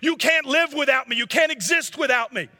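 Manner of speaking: very high, loud panicked yell; yelling lizard. A narrator's reading. Lizard yells at the boy as the angel draws his sword: very high panicked yell. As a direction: shriek it like a creature about to be cut off. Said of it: "the lizard begins to yell".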